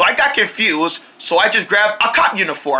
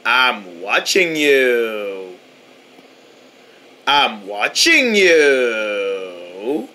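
very slow, low drawn-out threat; creepy slow stare; 10 semitones lower, 3 dB quieter, much slower than usual. Acted. Homeowner stalking the toast, very slow and low. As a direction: stretch every word like a horror villain.

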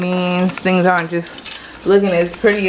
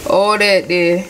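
ordinary talk, nothing marked

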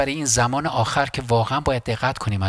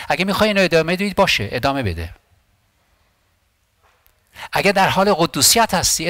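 preaching at full force; 6 semitones higher than usual. Real, not acted. Preacher urging the church to press on, fervent and rising. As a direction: preach it higher with each line.

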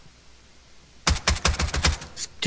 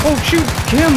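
startled shout; 8 dB louder than usual. Real, not acted. Sudden surprise in firefight.

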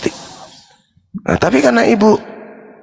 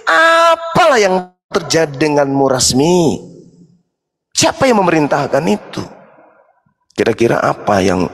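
ordinary delivery

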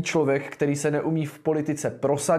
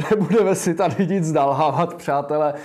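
laughing; 2 semitones higher, 5 dB louder than usual. Real, not acted. Laughing mid-sentence at opponent.

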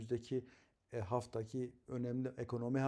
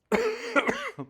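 sudden loud chesty cough; real cough breaking the sentence; 11 dB louder than usual. Real, not acted. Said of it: "kusura bakmayın arada öksürüyoruz". (göğüsten gelen öksürük)

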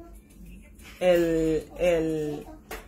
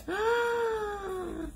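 thrilled cry; 10 semitones higher, 7 dB quieter than usual. Real, not acted. Reacting to the swatched colour.

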